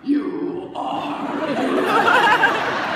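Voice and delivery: loud, slow, drawn-out mock deep call with a laugh; super deep voice. Telling the grizzly she is beautiful in a mock deep voice. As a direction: boom it out slowly like a mock tough guy. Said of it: "in my super deep voice".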